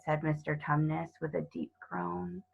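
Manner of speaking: ordinary delivery, nothing marked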